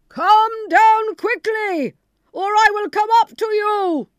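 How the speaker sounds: very high, loud, slow roar; bawling Bluebeard. Acted. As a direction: bellow up the stairs to terrify. Bluebeard threatens again.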